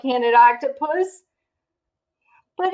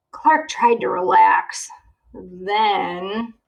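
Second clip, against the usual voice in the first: ordinary delivery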